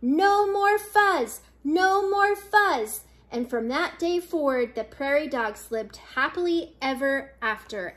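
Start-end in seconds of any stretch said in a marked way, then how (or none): 0.0-3.0 high, slow chant; crowd yell. A narrator's reading. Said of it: "the crowd yelled".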